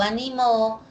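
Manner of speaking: "animó" stressed on final MÓ; stress on last syllable. Shown as a demonstration. Teacher contrasting accents for students, "animó" stressed last. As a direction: land on the end: a-ni-MÓ in "animó". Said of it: "aquí observamos oposición del acento".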